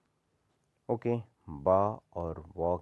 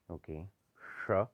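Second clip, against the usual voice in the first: ordinary delivery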